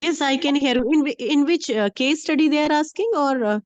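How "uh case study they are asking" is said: ordinary talk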